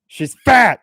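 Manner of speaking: hammed-up high shriek on FAT; mock outburst. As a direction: quote it with a sudden loud, high-pitched yell. Repeats the mock quote.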